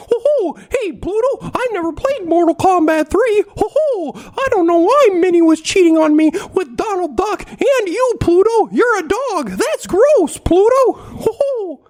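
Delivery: Mickey Mouse voice. Pretend. Mickey complaining to Pluto that Minnie left, squeaky falsetto. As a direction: squeak it out like Steamboat-era Mickey. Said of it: "That's a good one".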